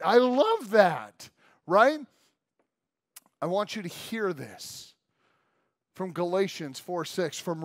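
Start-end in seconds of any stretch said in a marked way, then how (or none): 0.0-2.0 slow and louder with joy; delighted. Real, not acted. Preacher recalling his kids, slow delighted. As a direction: savor the memory.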